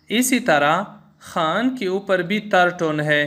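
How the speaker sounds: ordinary talk, nothing marked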